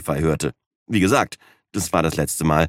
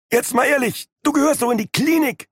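high, exasperated scolding voice. Acted. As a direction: tell him off sharply. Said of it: "schimpfte Wayne".

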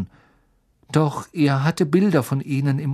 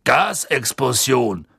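grumbled; 3 dB louder, slower than usual. Acted. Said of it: "brummte Ernie".

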